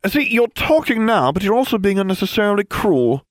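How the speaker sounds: plain reading voice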